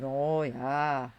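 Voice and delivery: low, long-voweled Minnesota accent; easygoing low Minnesota twang. Shown as a demonstration. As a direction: stretch the vowels, Minnesota style. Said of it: "to talk Minnesota".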